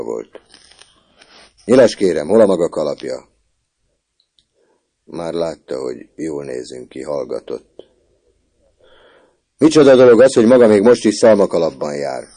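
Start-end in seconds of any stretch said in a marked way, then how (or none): 1.6-3.3 loud gruff prefect demanding an answer; grumpy prefect scolding the boy, loud; stern prefect. A narrator's reading. 9.6-12.2 loud, much higher outraged scolding; indignant prefect. A narrator's reading. Prefect scolds about straw hat.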